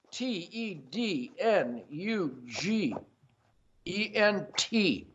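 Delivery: letter by letter, drawn out; slow spelling. Shown as a demonstration. Host spells autograph for viewers, slowly.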